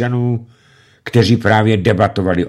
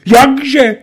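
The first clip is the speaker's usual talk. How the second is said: very high, loud exclamation; outraged cry; 10 dB louder than usual. A narrator's reading. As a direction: shriek in disbelief. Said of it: "vykřikl tučný bandita". (vykřiknout pohoršeně)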